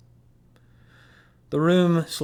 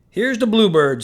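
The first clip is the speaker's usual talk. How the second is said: loud, high East Coast bray; 9 semitones higher, 10 dB louder than usual. A narrator's reading. Slade imitating Coach's loud East Coast bray. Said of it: "imitating Coach's East Coast braying".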